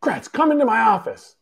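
a boss calling you in, firm; Craig Counsell summoning. Retold or imitated. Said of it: "Craig Counsell comes in, and he goes".

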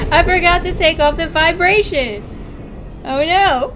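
loud, high-pitched and laughing; laughing dismay. Real, not acted. Streamer forgot to turn off vibration, laughing dismay. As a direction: wail it through laughter.

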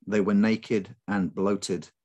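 usual speaking voice

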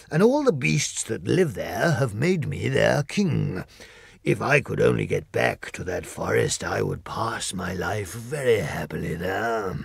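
a grand beast dreaming of his kingdom; Lion voice. Acted. Lion voiced with other timbre.

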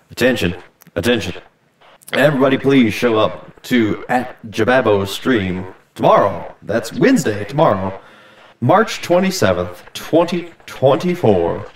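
loud announcer voice through a megaphone. Acted. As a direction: boom it out like an announcer. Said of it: "That's my announcer megaphone voice".

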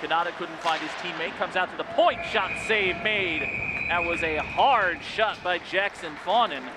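play-by-play. Acted. Live play-by-play.

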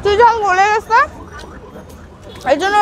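chewing while talking; eating rice and meat; mouth full of food. Real, not acted. Seen 2: Bite taken at -3; jaw and lips working with food during words.

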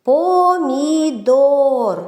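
Помідор very slow, both О sounds held long; виділений звук О. Shown as a demonstration. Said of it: "В цьому слові два звуки О". («Помідор» дуже повільно, з протяжними «о»)

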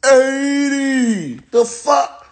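slow, high "Eighty" falling away; stunned disbelief. Pretend. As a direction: hammer home the number in disbelief. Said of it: "what I gotta say is slow for you?".

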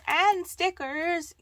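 loud, high, drawn-out exclamation; delighted surprise. Real, not acted. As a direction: burst out, stretching the words with glee. Delighted by extra stickers.

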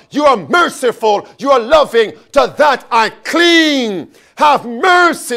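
loud, high, insistent prayer; fervent plea. Acted. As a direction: cry out to God, clinging to each phrase. Acts out David's fervent plea to God.

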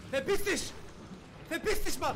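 very high, very loud scream; furious scream. Real, not acted. Gamer to the monster, screaming furiously.

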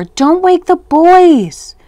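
high, loud, drawn-out scolding call; called-out order. A narrator's reading. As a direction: holler it slowly as a cross mother. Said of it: "called Mommy".

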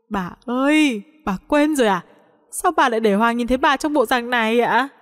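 plain reading voice

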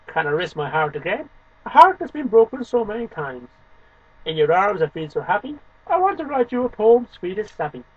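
measured, deliberate poetry reading with dramatic swings; expressive spoken-word poem. Acted. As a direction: read the poem like a performance, leaning on the contrasts. Reads own poem aloud.

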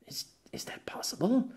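breathless unvoiced question; stunned whisper. Pierre realising the truth, stunned whisper. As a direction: barely get it out.

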